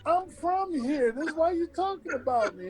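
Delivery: high, quick exasperated protest; indignant protest. Retold or imitated. Player answering the newspaper calling him villain, quick indignant protest. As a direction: fire back fast that you belong here. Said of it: "I'm sitting there going like".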